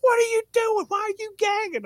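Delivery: very high, shrill-pitched mama; mama's voice. Retold or imitated. Mama finding her son gagging at the dog food, very high. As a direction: yelp like a startled mother.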